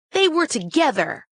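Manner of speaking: put-on high-pitched American girl's accusation; American accent. A narrator's reading. Girl accuses Grace of helping the thieves, American accent. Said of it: "she said in an American accent".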